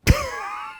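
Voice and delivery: squealing burst of laughter; high laugh. Real, not acted. Viewer cracking up at the nickname, high laugh.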